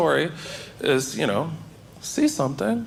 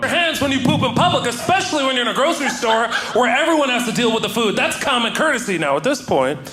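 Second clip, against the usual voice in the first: higher, righteous scolding; mock lecture; 7 semitones higher than usual. Lecturing the guy in the bulk aisle, raised mock scolding. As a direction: scold him like it's obvious. Continues yelling lecture at stranger.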